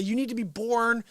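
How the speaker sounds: ordinary delivery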